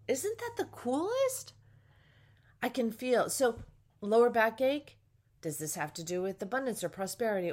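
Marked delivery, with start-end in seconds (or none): 0.1-1.5 much higher, thrilled squeal; light up with delight. Real, not acted. Delighted at her discovery.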